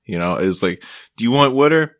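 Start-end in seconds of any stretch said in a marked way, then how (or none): 1.2-1.9 higher, louder mock Delaware Valley voice; playful Delaware Valley imitation; Delaware Valley accent. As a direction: put on Delaware Valley vowels, water as "would'er". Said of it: "Delaware Valley accent".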